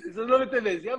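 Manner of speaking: high pitch, words through giggles; laughing. Real, not acted. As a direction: keep telling while laughing. Still laughing while telling.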